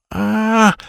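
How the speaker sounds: high, drawn-out "ah"; startled cry. A narrator's reading. Boy sees a giant bug coming, startled cry. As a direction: cry out high at a sudden scare.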